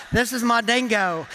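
lightly hammed-up Aussie voice; mock Australian accent. Pastor jokes his British accent comes out Australian. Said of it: "it comes out sounding Australian".

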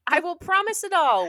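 eager princess. Acted. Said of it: "she answered".

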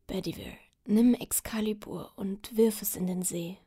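weak whispered final command; dying whisper. Acted. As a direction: whisper it with fading strength. Dying king gives last order.

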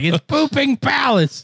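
high, drawn-out giddy exclamation; giddy disbelief. Retold or imitated. Explaining to a local why they laughed, giddy and high. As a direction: stretch it out way up high, tickled silly.

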